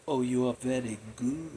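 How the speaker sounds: put-on soft, low Russian-accented purr; quiet mock Russian accent; imitated Russian accent. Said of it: "she had that Russian accent".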